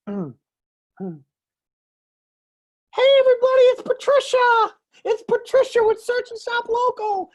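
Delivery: very high, bubbly receptionist voice, hammed up; Patricia voice. Recording a voicemail greeting as Patricia, high and gushing. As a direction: chirp it out like an over-excited greeter. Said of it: "Do I sound like Patricia?".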